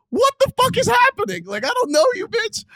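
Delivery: very high, loud squawk of disbelief; exasperated yelling. Retold or imitated. Driver overwhelmed by a stranger's messages, exasperated yelling. Retold outburst at stalker's messages.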